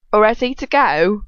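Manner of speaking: a goofy, questioning lilt; silly. Acted. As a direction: ham it up like a silly kid. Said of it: "she's so silly".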